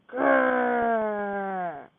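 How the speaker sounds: drawn-out playful bear growl; teacher showing a mock bear growl. Shown as a demonstration. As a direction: growl for the kids like a cartoon bear. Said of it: "Simon says, "Growl like a bear".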